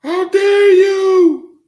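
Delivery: high, loud, slow outraged shout; mock outrage. Mimicking a jealous YouTuber scolding Tammy, hammed-up furious shout. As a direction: scream it like a betrayed friend, hammed up. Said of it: "I'm not gonna be mad like".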